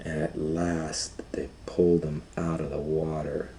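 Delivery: hushed storyteller whisper. A narrator's reading. Reading to the child, dropping to a whisper. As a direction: breathe the words, no voice.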